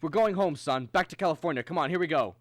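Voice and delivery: loud, pressing get-moving voice; urgent rallying. Acted. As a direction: hurry a child out the door. Rushes son out to flee.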